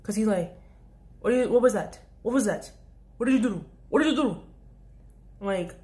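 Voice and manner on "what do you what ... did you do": mock angry customer.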